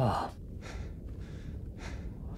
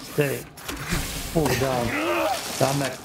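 angry yelling; 4 semitones higher than usual. Real, not acted. Fighting off a monster in game.

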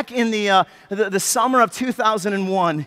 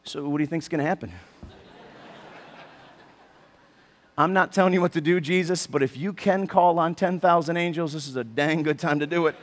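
lower, softer, quick sidelong murmur; quiet aside; 6 semitones lower, 6 dB quieter than usual. Pretend. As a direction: lean in and mutter it low. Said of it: "just standing there like".

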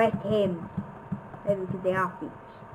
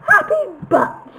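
much higher, giddy squeal; 14 semitones higher, 8 dB louder than usual. Pretend. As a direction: squeak it out giddily. Squealed chant.

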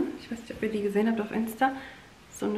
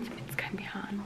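whispering; 14 dB quieter, faster than usual. Real, not acted. Embarrassed aside about outfit.

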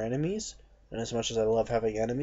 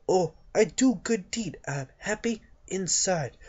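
higher, put-on bad Chinese accent, slowing on the end; a clumsy Chinese accent playing a monk; mock Chinese accent voicing a monk pleased with a good deed; 8 semitones higher, 4 dB louder than usual. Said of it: "That's a horrible, uh, fucking accent".

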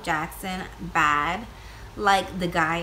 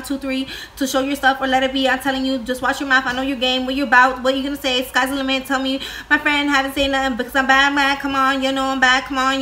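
louder rushed speed-read of the lyrics; breathless rapid-fire reading; fast lyric reading; 3 semitones higher, 7 dB louder, faster than usual. Shown as a demonstration. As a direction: rattle the lyrics off as fast as you can. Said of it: "see if I can read it as fast as he can".

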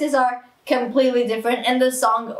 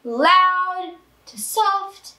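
LOUD held out, then soft dropped quieter; 6 semitones higher, much slower than usual. Shown as a demonstration. Singer explaining dynamics to viewers, loud to soft. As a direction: start LOUD and stretched, fade on soft. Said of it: "dynamics, which is getting".

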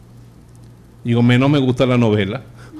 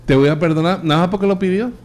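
pitched higher, a sarcastic rise on perdonar; mocking repeat; 6 semitones higher than usual. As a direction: throw the words back, unconvinced. Mocking repeat of God's words. (repetición burlona con subida en perdonar)

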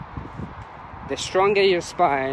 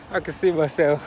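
ordinary delivery

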